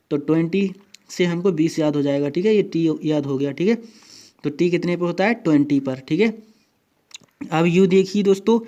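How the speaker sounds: ordinary delivery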